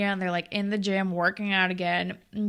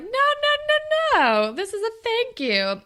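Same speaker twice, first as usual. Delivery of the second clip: mock protest; 13 semitones higher, 4 dB louder than usual. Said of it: "Stav was like".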